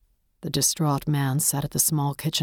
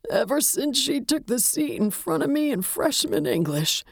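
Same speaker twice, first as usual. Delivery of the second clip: put-on high, wistful, grief-broken old widower; grieving old man; 8 semitones higher than usual. A narrator's reading. Widower recalls first admiring his wife in class, mournfully. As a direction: reminisce high and mournful, fading at the end.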